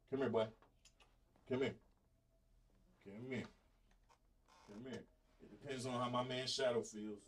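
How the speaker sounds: coaxing the dog. Real, not acted. Calling his dog Shadow over.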